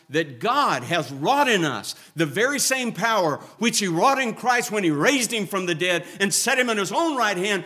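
loud, high and building to a roar; thundering pulpit crescendo; preaching at full force. Acted. Builds to resurrection power.

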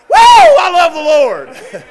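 big high whoop, then loud drawn-out praise; joyful shout. Shown as a demonstration. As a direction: shout praise across the congregation at full voice. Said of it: "So I just go and shout".

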